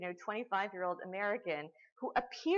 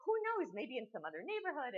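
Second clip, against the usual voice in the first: jumps much higher and louder, bewildered shrug; puzzled clueless host; 6 semitones higher, 3 dB louder than usual. Retold or imitated. Lecturer mocking the host giving up, high puzzled voice. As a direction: shrug it off like a cheerful know-nothing. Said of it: "and they're like".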